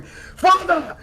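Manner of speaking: high, loud cry for his father; waking up screaming for his father; screamed "Father!". Acted. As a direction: scream it out of a nightmare.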